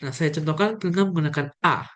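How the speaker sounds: usual speaking voice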